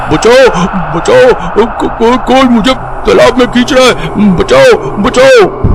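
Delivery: high, stammering screams for help; anguished cry. Acted. Uncle bathing in the pond screams for rescue, high stammering panic. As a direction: cry out in panic while being dragged under. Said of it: "उनका अंतनाद सुनाई दिया".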